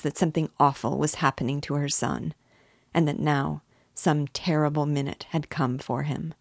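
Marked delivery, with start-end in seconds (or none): none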